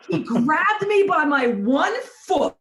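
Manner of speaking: usual speaking voice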